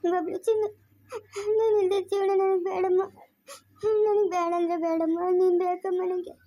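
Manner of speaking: grieving mother pleading through sobs; crying. Real, not acted. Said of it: "ಯಾಕಮ್ಮ ಅಳ್ತಾ ಇದ್ಯಾ?".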